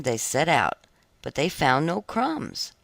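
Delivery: ordinary delivery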